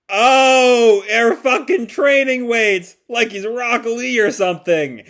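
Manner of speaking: loud, high delighted outburst; thrilled at the gag; real geeky excitement; excited. Real, not acted. Delighted at the training weights.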